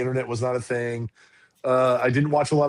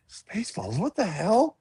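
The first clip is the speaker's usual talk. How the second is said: hushed puzzled word, then higher disbelief; retold bafflement; 5 semitones higher, 4 dB quieter than usual. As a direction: replay confusion, starting very soft. Retells bafflement at sign.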